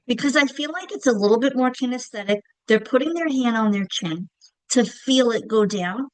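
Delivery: usual speaking voice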